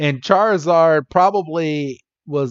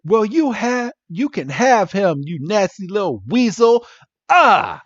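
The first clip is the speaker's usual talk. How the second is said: higher-pitched mock outburst, ending in an exasperated cry; play-acted fed-up snap, voice pitched up; snapping in anger; 5 semitones higher than usual. Acting out Misty losing it at Jessie, mock angry snap. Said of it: "And Misty just snaps".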